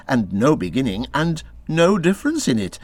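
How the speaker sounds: ordinary delivery, nothing marked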